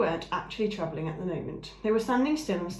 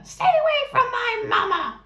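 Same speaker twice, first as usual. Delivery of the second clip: put-on much higher, loud young bird voice; a little goose guarding his mother; gosling hissing; 9 dB louder than usual. A narrator's reading. Gosling defending his mother from the ram, fierce and high. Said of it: "he hissed".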